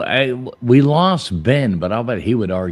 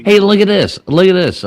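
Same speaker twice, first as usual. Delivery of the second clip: excited; 10 semitones higher, 7 dB louder than usual. Real, not acted. Calling out a friend arriving.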